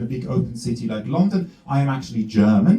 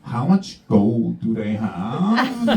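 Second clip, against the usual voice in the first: slow sly question; 2 semitones lower, much slower than usual. Retold or imitated. Mock Swiss asking about gold.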